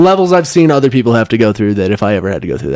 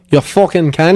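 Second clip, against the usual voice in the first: put-on Australian accent; 3 semitones higher than usual. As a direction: throw the insult like a mock Aussie bloke. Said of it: "How do you say it in Australian accent?".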